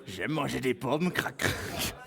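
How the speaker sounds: slow, low, lightly voiced Chirac; a clumsy take on Chirac chewing an apple, slowly; Jacques Chirac impression. Streamer does a bad Chirac for a viewer, slow and low. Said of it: "euh, Jacques Chirac".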